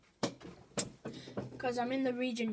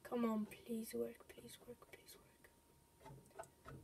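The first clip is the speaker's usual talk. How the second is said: fading from soft plea to a bare whisper; begging whisper; 14 dB quieter, slower than usual. Real, not acted. Gamer pleading with the game to let him in, fading to a whisper. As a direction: pray under your breath that it works.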